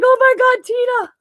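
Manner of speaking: high, loud shocked cry of a friend; imitating a friend's stunned Oh my God; shocked squeal. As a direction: squeal the friend's name in disbelief. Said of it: "She's like".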